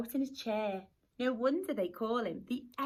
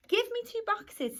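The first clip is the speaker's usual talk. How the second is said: put-on old fish-shop lady; 9 semitones higher, 3 dB louder than usual. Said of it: "said old Missus Bishop".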